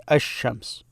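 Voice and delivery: ash-shams with no L and a doubled sh; assimilated sh. Shown as a demonstration. Teacher showing what they say when talking, ash-shams without L. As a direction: say ash-shams as spoken, L fused into sh. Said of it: "when they're talking, they say".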